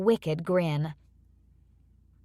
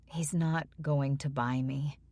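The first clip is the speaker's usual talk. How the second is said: miserable; 4 semitones lower, 6 dB quieter than usual. Acted. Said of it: "I said miserably".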